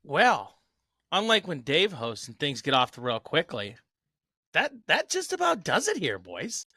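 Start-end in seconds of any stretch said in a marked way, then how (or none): 4.5-6.7 mock sign-off voice. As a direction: jokingly wrap it up way up high, then drop on "boys". Joking mock sign-off to co-hosts.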